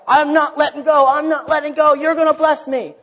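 clinging on, shouting it out with full resolve; stubborn shout. Retold or imitated. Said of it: "And, and Jacob's like".

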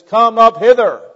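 loud, high commanding call; acted shout. Preacher acts out Jesus shouting from heaven, loud high shout. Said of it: "when he shouts, he says".

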